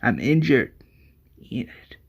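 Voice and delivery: halting, pained low groan; acted dying man's effort; badly wounded. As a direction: speak as if injured, fading to a whisper. Dying man speaks in story.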